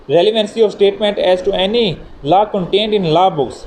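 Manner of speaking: reading a statute title word by word, very slowly; measured, deliberate legal reading; slow formal heading read. Acted. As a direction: dictate the heading slowly so students can note it. Reads the article heading aloud.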